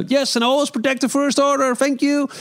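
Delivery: high, stiff trooper voice; stormtrooper voice. Retold or imitated. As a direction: dismiss them like a stormtrooper. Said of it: "die stormtrooper zegt".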